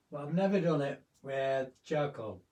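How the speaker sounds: ordinary talk, nothing marked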